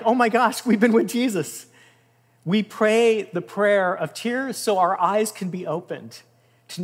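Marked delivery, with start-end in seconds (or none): 0.0-1.7 higher and louder, gasping in wonder; astonished realization. Retold or imitated. Disciples on the road to Emmaus suddenly see Jesus, stunned and amazed. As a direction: burst out like the truth just hit you.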